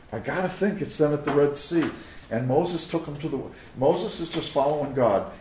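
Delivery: loud, driving sermon voice; preaching at full force. Real, not acted. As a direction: preach it with rising urgency.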